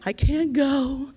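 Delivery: slow, tearful and drawn out; imitating a tearful senior who cannot go. The old woman telling her organizer she can't go, tearfully.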